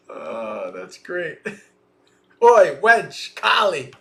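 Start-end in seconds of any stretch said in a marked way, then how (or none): 2.4-3.9 loud, high holler for a servant; calling out. Acted. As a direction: play a mistress yelling for her maid. Acted mistress calling her maid.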